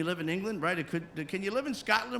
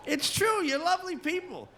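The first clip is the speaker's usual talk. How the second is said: ordinary delivery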